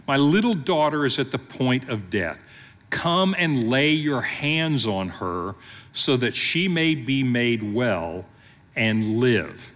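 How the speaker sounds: ordinary talk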